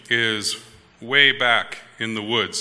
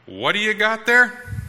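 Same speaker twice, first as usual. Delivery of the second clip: higher and louder replay of the question; the question pitched up and pushed louder; replayed question raised; 9 semitones higher, 4 dB louder than usual. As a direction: lift it up sharply for the punchline. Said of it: "And Titus says".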